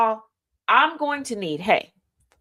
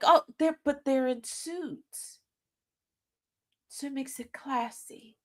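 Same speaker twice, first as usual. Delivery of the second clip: higher, softening mock justification; put-on apologist, trailing off softer; mock defending; 4 semitones higher, 6 dB quieter, slower than usual. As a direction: imitate someone making a feeble excuse. Mimicking people who defend the image.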